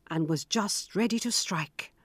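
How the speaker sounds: plain reading voice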